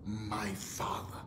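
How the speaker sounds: slow whispered declaration; whispered confession. Shown as a demonstration. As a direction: whisper God is my father slowly. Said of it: "say it with me".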